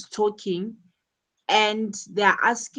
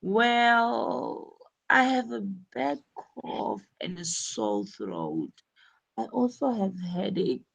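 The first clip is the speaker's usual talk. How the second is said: "Well" stretched, then softer unsure complaint; hesitant patient; 2 semitones higher, 4 dB quieter than usual. Shown as a demonstration. Teacher voicing Kathy telling the doctor her symptoms. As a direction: hedge and stall before answering. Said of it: "what she said is".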